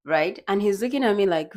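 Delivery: ordinary talk, nothing marked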